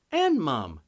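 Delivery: put-on high little girl's voice; small child. Little daughter chiming in to her parents, high and childlike. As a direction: chirp it like a kid.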